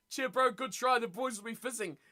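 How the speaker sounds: ordinary talk, nothing marked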